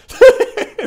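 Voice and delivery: big high burst of laughter; loud laugh. Real, not acted. Laughs at channel age.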